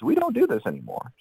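doctor dismissing it. Retold or imitated. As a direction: squeak it out like the fed-up doctor.

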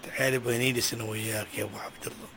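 low, soft complaint of hardship; a tired man pouring out his troubles; weary lament. Acted. Father confessing money troubles to his friend, low weary lament.

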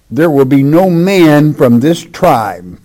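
preacher reading judgment; solemn scripture reading. Acted. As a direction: pronounce the prophecy with weight.

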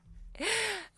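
high burst of laughter, gasping for air; breathless laugh. Real, not acted. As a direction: laugh until you run out of breath. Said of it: "I had to catch my breath".

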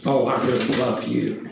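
low, quiet, unconvincing declaration of love; deadpan mock affection; insincere. Shown as a demonstration. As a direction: say it low and soft, not meaning a word. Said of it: "what did my manner of communicating it do".

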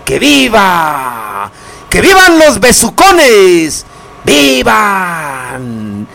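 slow, drawn-out cheers; stretched festive cries, falling at the end; festive viva shouts. Acted. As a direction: call out toasts like a fiesta announcer. Radio host calling out vivas.

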